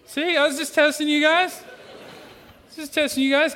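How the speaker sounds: high, playful cover-up after a slip; mock innocent. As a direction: pretend you meant the mistake all along.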